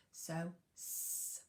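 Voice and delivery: S as a long hissed ssss; ss sound. Shown as a demonstration. Teacher to reception class, hissing the ss sound. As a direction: hiss out S as a long unvoiced ss. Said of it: "looking at the sound S".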